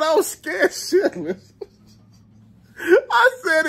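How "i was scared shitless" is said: high, slow, breaking into laughter; laughing confession. Real, not acted. Man telling wife he was scared, laughing. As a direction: laugh through the admission.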